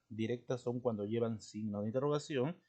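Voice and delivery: usual speaking voice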